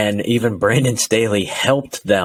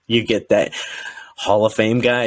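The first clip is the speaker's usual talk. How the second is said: ordinary talk, nothing marked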